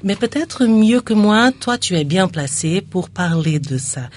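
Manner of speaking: normal talking voice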